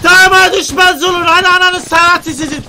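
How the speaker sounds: loud, high yells mid-fight; heated shouting. Real, not acted. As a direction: bark orders so the whole team hears. Yelling at team mid-fight. (heyecanla bağırarak)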